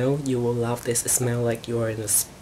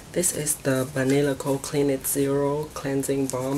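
ordinary talk

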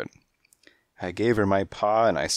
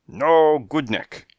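loud, high, slow angry snap; snapped; 9 semitones higher, 8 dB louder, slower than usual. A narrator's reading. Fortune-teller snapping at the detective, loud and angry. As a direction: spit it out in disgust. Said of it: "she snapped".

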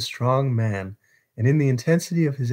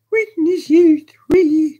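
put-on high, drawn-out boyish cry; shrill, worked-up boy calling witnesses; excited boy's cry; 8 dB louder, slower than usual. A narrator's reading. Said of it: "cried the boy".

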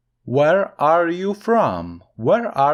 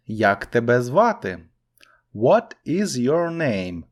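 ordinary talk

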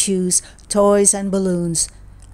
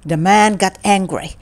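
ordinary delivery, nothing marked

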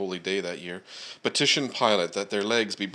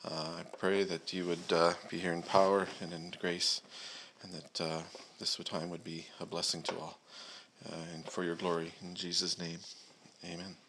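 quiet prayer; 2 semitones lower, 8 dB quieter than usual. Acted. Pastor praying before the scripture reading, quiet and reverent. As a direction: pray it gently to God.

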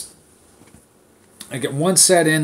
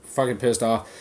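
ordinary delivery

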